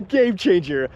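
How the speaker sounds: slow, laughing repeat. Real, not acted. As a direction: laugh through the words.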